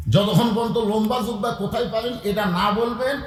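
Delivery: ordinary talk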